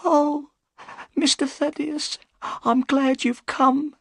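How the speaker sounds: put-on high, pleading old woman's voice; a scared servant begging for help; frightened old housekeeper. A narrator's reading.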